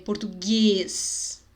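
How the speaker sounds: "português" slow, with a tight closed e at the end. Shown as a demonstration. Teacher giving an example, slow closed vowel. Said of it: "O E é bem fechado".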